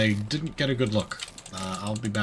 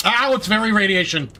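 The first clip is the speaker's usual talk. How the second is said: pained yell; 10 semitones higher, 11 dB louder than usual. Real, not acted. Pained outburst in radiation zone.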